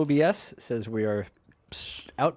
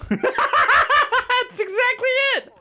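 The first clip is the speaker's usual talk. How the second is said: high squealing laugh through the words; cracking up so hard the words squeak out high and slow; 5 dB louder, much slower than usual. Real, not acted. Laughing at a friend's spot-on impression, high and slow.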